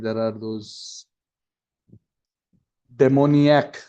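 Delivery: ordinary talk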